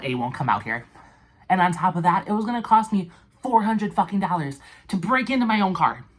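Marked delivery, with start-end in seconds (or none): 1.5-6.1 higher and louder, building outrage; four hundred dollars, outraged; indignant rant. Real, not acted. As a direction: push the fury up to the end. Building rant about cost.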